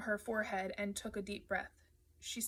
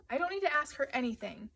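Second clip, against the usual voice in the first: higher, louder refusal; defiant; 5 semitones higher, 5 dB louder than usual. A narrator's reading. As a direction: stand up for a friend, raised voice. Narrator defends Rossi in quarrel.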